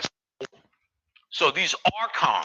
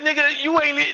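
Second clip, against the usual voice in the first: mock disbelief; 12 semitones higher than usual. Mock reaction to smelly attendee.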